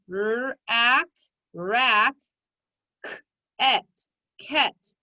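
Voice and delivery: stretched phonics sounds, then each syllable blended, "rack", "ket"; sounding out. Shown as a demonstration. Teacher decoding a word for pupils, sounding it out. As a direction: blend the sounds of "R-ack" and "k-et" one piece at a time. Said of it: "Let's try to read the word".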